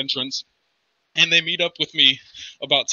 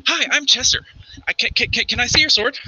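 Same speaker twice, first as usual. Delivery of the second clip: put-on high, childlike cat-folk voice; bright-eyed little catfolk, pitched up; eager Chester; 9 semitones higher than usual. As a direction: stammer out an innocent request in a high voice. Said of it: "And it's like".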